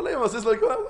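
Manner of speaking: quick, offhand mock recital; imitated indifference, hurried; unbothered. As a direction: rattle off the passage without caring. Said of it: "הכל טוב".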